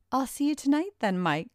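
high, quick called goodbye; calling out. A narrator's reading. Karen to Mike as he leaves the mall, calling out. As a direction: call it after him as he leaves. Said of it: "called out Karen".

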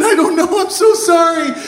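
high-pitched blubbering plea; hammed-up tearful apology; crying kid. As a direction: wail helplessly, imitating a sobbing kid. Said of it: "the kid starts to cry too".

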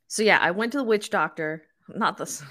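usual speaking voice

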